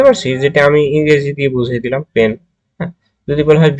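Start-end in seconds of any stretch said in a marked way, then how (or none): none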